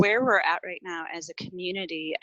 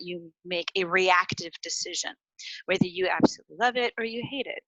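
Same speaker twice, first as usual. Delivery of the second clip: normal talking voice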